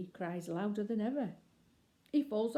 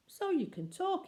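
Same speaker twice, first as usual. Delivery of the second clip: much higher, delighted surprise; amazed; 12 semitones higher than usual. Acted. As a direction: marvel that the baby talks. Bill amazed baby speaks.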